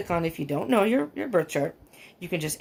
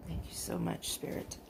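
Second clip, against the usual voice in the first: soft, reverent invocation to Spirit; hushed prayer to Spirit; 8 dB quieter than usual. Real, not acted. Tarot reader softly asks Spirit for Capricorn messages, hushed and reverent. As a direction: quietly call on Spirit, eyes closed.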